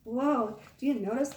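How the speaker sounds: ordinary delivery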